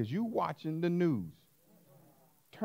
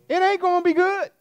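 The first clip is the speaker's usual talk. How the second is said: much higher and louder, sustained; cried-out warning; 11 dB louder than usual. Acted. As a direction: cry it out over the congregation. Preacher crying out a warning.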